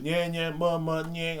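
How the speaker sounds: high, slow lazy mumble-singing; mock kid mumbling. Host mocking kids mumbling a song, high slow mumble. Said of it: "watch these little kids mumble".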